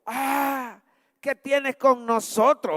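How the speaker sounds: high, drawn-out cry, only partly shouted; mild demon cry. Acted. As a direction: act out a possessed man crying out, restrained. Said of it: "Empezó a gritar diciendo". (grito contenido de endemoniado)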